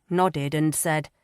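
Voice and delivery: usual speaking voice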